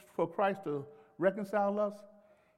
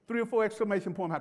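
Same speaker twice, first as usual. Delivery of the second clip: ordinary talk, nothing marked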